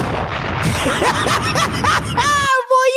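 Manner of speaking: cracking up at his own roast; high cackling laugh. Real, not acted. Laughing at his own roast of the coach, high cackle.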